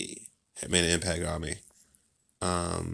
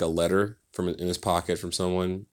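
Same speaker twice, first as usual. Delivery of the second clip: ordinary talk, nothing marked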